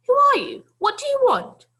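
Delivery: put-on high, loud old lady demanding who he is; old lady challenging. Old lady confronting a runaway boy, loud and high. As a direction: snap the question up high and sharp.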